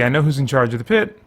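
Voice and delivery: ordinary talk, nothing marked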